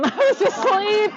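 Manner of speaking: high, loud, laughing confession; laughing admission. Real, not acted. Teacher admits missing the supermoon to class, laughing. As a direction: crack up while owning up. Joking about missing the moon.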